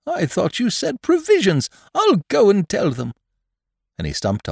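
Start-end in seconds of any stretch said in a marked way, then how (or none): 0.1-3.1 high, eager storybook bear; pleased Pooh-style voice, pitched well up; happy bear. Acted. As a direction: chirp it happily in a high character voice. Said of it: "said Pooh happily".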